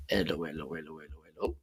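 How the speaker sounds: soft, fading repeated hellos. Shown as a demonstration. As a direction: test the mic with the volume turned down. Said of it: "let me put it a little bit down".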